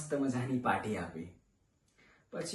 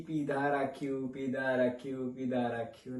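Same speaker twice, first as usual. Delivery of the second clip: low and lingering; 6 semitones lower, 6 dB quieter than usual. Acted. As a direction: stretch each phrase as the habit drags on. Repeated drinking sinking into drunkenness.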